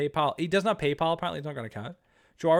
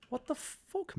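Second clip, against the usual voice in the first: surprised; 8 dB quieter, much slower than usual. Real, not acted. Scam confusion.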